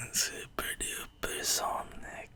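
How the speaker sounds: slow secret whisper. Acted. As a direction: hush it like a secret. Secret hush aside.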